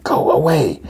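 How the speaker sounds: high, drawn-out mock warning; imitated high-pitched call to leave; warding-off call. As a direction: wail it from afar to send them off. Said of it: "telling him to leave".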